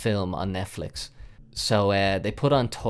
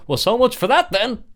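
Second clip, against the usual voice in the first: higher, louder put-on girlfriend voice; girlfriend's mock voice; 12 semitones higher, 7 dB louder than usual. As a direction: play the girlfriend cracking a joke.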